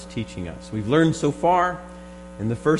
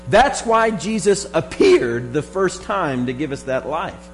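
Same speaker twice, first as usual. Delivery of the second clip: rising, louder fervent preaching; 6 semitones higher, 5 dB louder than usual. Acted. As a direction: preach it with growing fervour. Preaching builds to full force.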